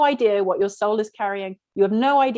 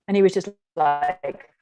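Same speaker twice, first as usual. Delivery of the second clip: ordinary delivery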